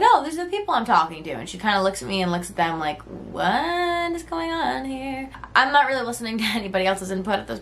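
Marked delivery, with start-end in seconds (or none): 0.0-1.3 chirpy rushed introduction; bright, fast. Retold or imitated. 3.0-5.3 high, slow, quiet bewilderment; puzzled. Retold or imitated. Friend's puzzled look.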